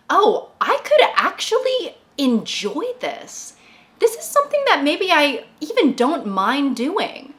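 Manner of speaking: pleased surprise. Retold or imitated. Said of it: "I was like".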